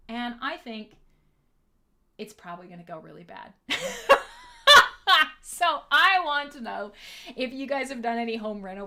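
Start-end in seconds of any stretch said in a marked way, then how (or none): 3.6-5.4 loud high cackle; loud burst of laughter. Real, not acted. Laughing at her own reckless reno plan, loud cackle.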